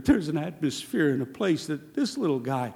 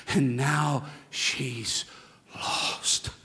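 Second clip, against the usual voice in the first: breathy whisper; 4 semitones lower, 5 dB quieter, much slower than usual. Acted. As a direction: trail off into a whispered "lost". Sermon climax, dropped to breath.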